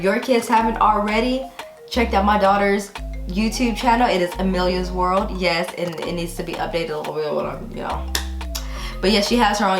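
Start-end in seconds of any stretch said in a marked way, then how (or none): none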